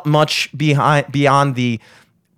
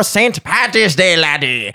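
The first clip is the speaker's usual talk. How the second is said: pirate voice; 4 semitones higher than usual. Acted. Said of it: "I'm sounding more like a pirate".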